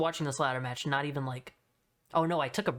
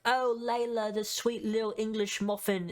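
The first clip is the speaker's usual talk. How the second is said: higher, lightly put-on English accent; mock English accent; 6 semitones higher than usual. Commentator praising Layla, lightly mocking English accent. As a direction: croon it like a cheesy announcer faking an English accent. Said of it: "Why, why the accent".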